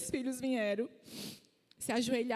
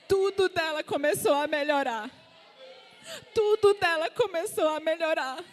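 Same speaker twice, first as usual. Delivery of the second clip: loud, high testimony shout; ringing preacher's climax; triumphant proclamation; 6 semitones higher, 6 dB louder than usual. Real, not acted. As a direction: proclaim it to the whole church, voice raised.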